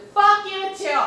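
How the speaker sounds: furious comeback shout; angry retort. Retold or imitated. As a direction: snap it back hard. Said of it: "finally, I said".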